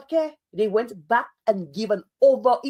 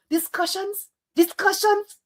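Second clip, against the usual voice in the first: incredulous repeat; 9 semitones higher than usual. Real, not acted. Vlogger scoffing at the government's word, incredulous. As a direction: repeat it like you can't believe the word.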